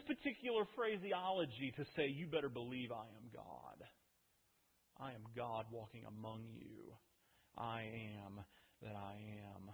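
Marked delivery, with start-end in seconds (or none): 2.1-9.7 softer, hushed divine claim; solemn declaration. Acted. Jesus declaring his deity to the crowd, hushed and solemn. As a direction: let it sink in, quiet and weighty.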